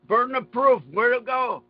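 ordinary delivery

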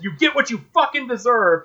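imitated yell, pitched high without much volume; mild mock yell. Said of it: "then he just yells".

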